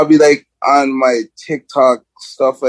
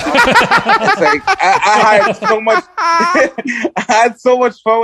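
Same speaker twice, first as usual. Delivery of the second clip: laughing; 9 semitones higher, slower than usual. Real, not acted. Laughs while talking.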